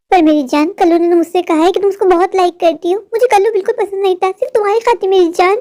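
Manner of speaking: ordinary delivery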